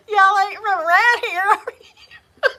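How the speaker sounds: high, loud hammed-up Southern drawl, laughing; mock Southern drawl. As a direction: belt out a teasing Southern imitation through laughter. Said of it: "never picked up the drawl".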